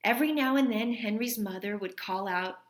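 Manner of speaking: gentle read-aloud storytelling voice; story reading. A narrator's reading. Teacher reading a story to her class, gentle read-aloud. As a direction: read it like a teacher sharing a picture book with kids. Reads a picture book aloud.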